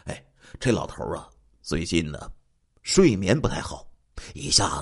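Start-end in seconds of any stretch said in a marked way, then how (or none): none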